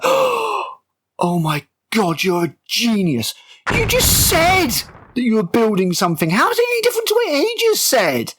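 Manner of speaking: mock the character's praise, dripping sarcasm; sarcastic mock amazement.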